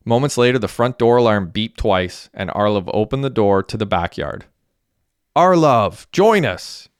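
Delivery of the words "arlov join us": high, loud calling out; yelled invitation. A narrator's reading. Derek calling Arlov over to the backyard, yelled. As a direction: call a friend over with drawn, pitched-up shout. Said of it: "yelled Derek".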